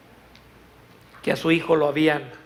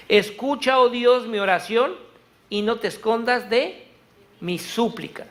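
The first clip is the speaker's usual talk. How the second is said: raised, ringing psalm read from the pulpit; fervent scripture reading; 6 semitones higher, 4 dB louder than usual. Acted. Preacher reading a psalm to the church, fervent and ringing. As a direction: proclaim the psalm to the congregation.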